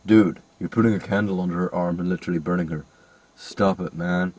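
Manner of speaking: low-pitched mock protest; Keanu Reeves. Cameraman objecting to burning her arm, low impression. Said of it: "cameraman was just Keanu Reeves".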